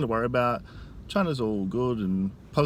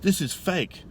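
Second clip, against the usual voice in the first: stern, higher flat denial; serious; 8 semitones higher, 3 dB louder, slower than usual. Retold or imitated. Man at McDonald's denying Tiananmen, stern and curt. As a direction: imitate him going cold and serious. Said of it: "he gets real serious".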